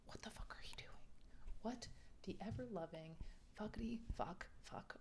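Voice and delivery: hushed, aghast whisper at the page; horrified whisper. Real, not acted. Reader whispering at the reckless heroine, horrified whisper. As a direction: whisper it in stunned disbelief.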